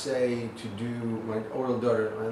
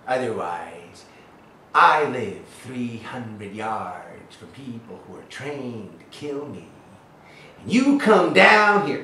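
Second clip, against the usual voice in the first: lightly mocked teacher; 8 dB louder than usual. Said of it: "closed-minded acting teacher says".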